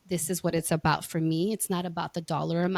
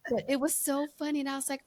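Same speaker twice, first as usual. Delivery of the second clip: ordinary delivery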